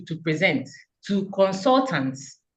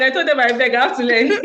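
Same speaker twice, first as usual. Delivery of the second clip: laughing plea; 6 semitones higher, 6 dB louder, faster than usual. Real, not acted. Pleading laughingly about presenting.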